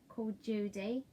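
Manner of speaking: plain reading voice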